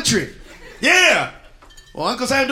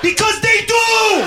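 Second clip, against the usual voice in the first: drawn-out comic shout; big shout; 9 semitones higher, slower than usual. Acted. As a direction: bellow it out with mock outrage. Comic answers heckler loudly.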